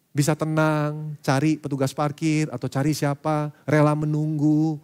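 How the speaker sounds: slow and calm, as if waiting patiently; calm slow demo. Shown as a demonstration. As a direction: speak it slowly, unhurried. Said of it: "respon hatinya kan, itu yang diuji". (ucapkan perlahan dan tenang, penuh kesabaran)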